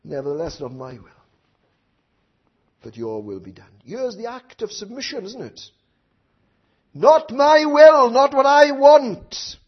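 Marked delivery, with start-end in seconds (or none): 0.1-3.8 low, soft yielding; quiet submission. Acted. Jesus yielding to the Father, hushed and low. 6.9-9.6 loud, slow, higher declaration; forceful submission. Acted. Preacher proclaiming Jesus's submission, loud and slow.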